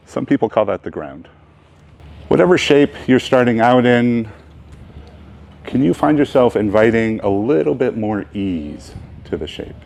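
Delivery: unhurried phrases with long, spacious pauses; guided meditation. Real, not acted. Teacher leading the opening meditation of a beginners' yoga class, unhurried. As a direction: guide them into ease, leaving room to breathe.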